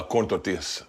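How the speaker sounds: quiet, exaggerated Mexican pronunciation; overdone Mexican accent. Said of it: "The fucking accent".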